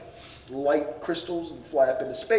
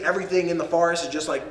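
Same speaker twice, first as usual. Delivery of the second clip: ordinary delivery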